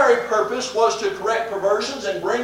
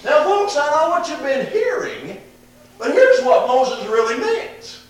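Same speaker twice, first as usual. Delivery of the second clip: higher, chatty mock-teacher voice, slowing at the end; folksy explainer; 6 semitones higher, 4 dB louder than usual. Preacher mimicking a hypothetical folksy Jesus correcting hearers, higher. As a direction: play a folksy preacher setting folks straight. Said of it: "He doesn't say".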